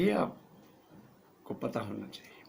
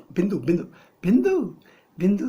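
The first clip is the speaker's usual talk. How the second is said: shouts बिंदु, jumping sharply higher and louder; exasperated shout; 5 semitones higher, 9 dB louder than usual. Acted. Director yelling बिंदु at the clueless hero. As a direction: yell बिंदु at the dim actor.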